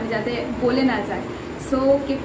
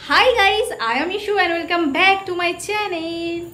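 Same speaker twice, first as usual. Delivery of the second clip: bright, drawn-out YouTube greeting; channel intro; 5 semitones higher, much slower than usual. Shown as a demonstration. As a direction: greet the viewers like a host opening the show. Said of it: "তো চলো এবার আমার ইন্ট্রোটা দিয়ে". (চ্যানেলের পরিচিতি, টেনে টেনে)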